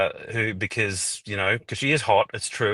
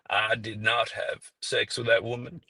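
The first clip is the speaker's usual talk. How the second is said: Bill Clinton impression; 3 dB quieter than usual. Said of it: "favorite one to do is Bill Clinton".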